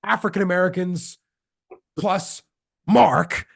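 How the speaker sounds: quoting a fired-up coach, building to the name; mildly hyped imitation of a winning coach; excited coach. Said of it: "he's, like, all excited".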